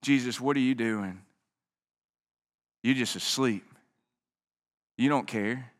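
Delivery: drained, barely getting the words out; tired men past the end of their strength; exhausted disciples. Acted. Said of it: "No, no, they're exhausted".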